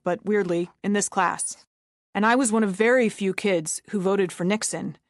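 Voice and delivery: normal talking voice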